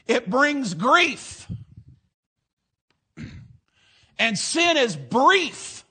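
rhythmic, emphatic pulpit preaching. Acted. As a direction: preach it with rising rhythm to the congregation. Preacher delivering sermon.

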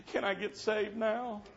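high, slow, pleading question; imitated tearful plea. Girl looking over at the preacher after crying, imitated tearful plea. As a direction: mimic a girl asking through tears. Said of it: "started crying".

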